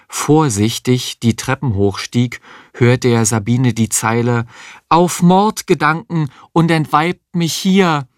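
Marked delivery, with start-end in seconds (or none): 4.9-8.1 high-pitched stage declamation; theatrical declaiming. Acted. Sabine rehearsing a Macbeth line in the bathtub, declaiming. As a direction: declaim it like an amateur Lady Macbeth. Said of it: "aus Macbeth deklamieren".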